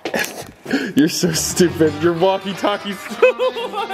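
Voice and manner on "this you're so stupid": ordinary delivery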